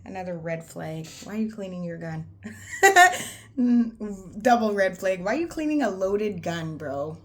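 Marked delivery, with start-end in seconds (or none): 2.3-3.5 a burst of high loud laughter. Real, not acted. Recalling a gun red flag, loud laugh. 3.6-7.2 loud, still laughing. Real, not acted. Mocking a second red flag, laughing.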